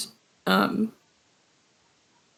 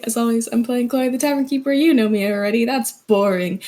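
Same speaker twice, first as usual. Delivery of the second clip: ordinary talk, nothing marked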